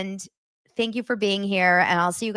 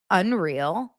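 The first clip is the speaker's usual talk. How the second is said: ordinary talk, nothing marked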